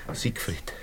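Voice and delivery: unvoiced, soft signature; whispered name. Landlord reading the dead boy's note, whispered. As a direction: breathe the name like a farewell.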